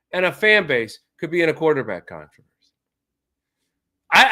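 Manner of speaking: ordinary talk, nothing marked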